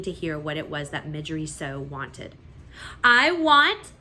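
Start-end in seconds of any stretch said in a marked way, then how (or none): 3.0-3.9 loud, high start; loud declaration. A narrator's reading. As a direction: blurt it out. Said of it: "said Midge".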